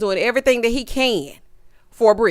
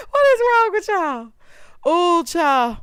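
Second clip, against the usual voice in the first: high, raised-voice disbelief; loud, pitched-up exasperation; exasperated shout; 9 semitones higher, 4 dB louder, slower than usual. Real, not acted. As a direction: cry out at their foolishness. Exasperated reaction to the couples.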